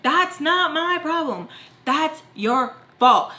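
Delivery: slow, high, punched-out comeback; defiant retort. Retold or imitated. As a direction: re-enact throwing the blame back, word by word. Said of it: "And I'm like".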